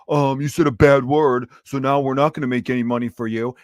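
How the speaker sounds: mock the platform's scolding tone; mock YouTube voice. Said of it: "YouTube's being all like".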